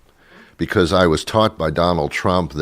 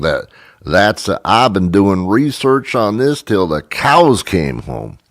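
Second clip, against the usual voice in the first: hammed-up slow Southern drawl; Southern accent. Host mocking how unintelligent it sounds, exaggerated Southern accent. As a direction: stretch the vowels like a mock Southerner. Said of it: "The Southern accent is not the biggest sound".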